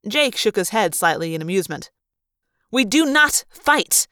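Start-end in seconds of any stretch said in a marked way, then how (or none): 2.7-4.1 higher, louder, slow and hammered; heated insistence, each word pressed; heatedly. A narrator's reading. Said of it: "Maria said heatedly".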